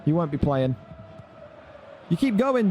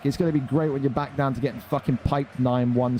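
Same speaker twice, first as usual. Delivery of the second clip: usual speaking voice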